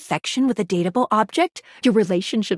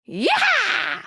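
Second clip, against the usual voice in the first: high, drawn-out whoop; excited cheer. Acted. Exclaiming amid wild events.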